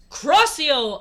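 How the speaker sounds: high, loud acted shout; sudden cry, falling at the end; shouted spell. A narrator's reading. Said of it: "I shouted".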